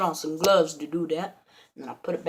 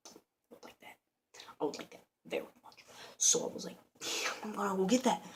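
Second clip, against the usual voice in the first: near-whispered coveting; hushed aside; 9 dB quieter than usual. Retold or imitated. Burglar coveting the gold shotgun, hushed aside. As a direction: say it very softly, as if to yourself.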